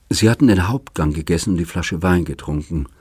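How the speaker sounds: ordinary delivery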